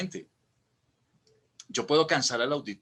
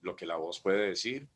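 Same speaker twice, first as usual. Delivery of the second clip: mock low monotone; 6 semitones lower, 5 dB quieter than usual. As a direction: lower and softer, sleepy. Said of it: "siempre utilizar el tono abajo".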